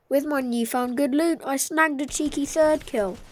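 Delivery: usual speaking voice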